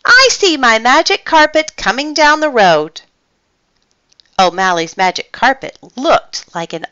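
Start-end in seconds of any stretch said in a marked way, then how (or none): 0.0-3.0 excited high-pitched call. A narrator's reading. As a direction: call after them eagerly, voice up high. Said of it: "called O'Malley".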